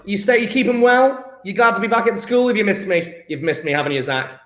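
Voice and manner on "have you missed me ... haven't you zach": usual speaking voice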